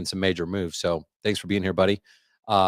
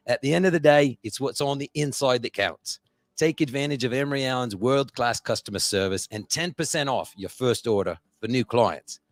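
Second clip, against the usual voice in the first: sponsor read in a hammed-up Aussie or Irish accent, pitched higher; put-on Aussie or Irish accent; 4 semitones higher than usual. Host reading the sponsor ad to listeners in a mock Aussie or Irish accent. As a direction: sell it in a half-Aussie, half-Irish lilt. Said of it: "today it's a different accent".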